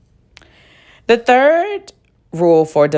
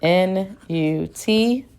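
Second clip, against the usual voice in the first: spelled out slowly, letter by letter; slow, careful dictation; slow letter spelling; 3 semitones higher, much slower than usual. Shown as a demonstration.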